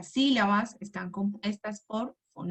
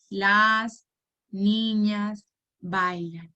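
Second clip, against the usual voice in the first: counting the words; much slower than usual. Shown as a demonstration. Teacher counts the words aloud for the child, slowly one by one. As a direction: count each word out slowly and separately. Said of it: "vamos a contar".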